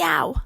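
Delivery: loud sudden yelp of pain. Acted. Kate hitting a tree branch, yelping ow. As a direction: yelp as your head hits.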